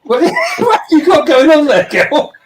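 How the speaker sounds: high laughing call; laughing shout. Real, not acted. Talking to a dog, laughing.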